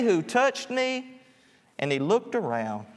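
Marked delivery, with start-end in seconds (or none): 0.0-1.2 high, slow, drawn-out mock question; mocking disbelief. Disciples ridiculing Jesus in the crowd, mock incredulous. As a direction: echo his words as if they're absurd. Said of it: "the disciples kind of ridiculed him".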